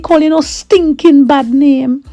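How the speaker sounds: high, firm protective mother; fierce mum. Retold or imitated. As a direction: lay down the law like a proud mum. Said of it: "my mum was like".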